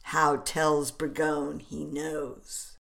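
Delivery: plain reading voice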